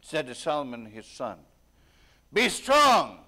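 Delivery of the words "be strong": forceful charge. Acted. David charges Solomon.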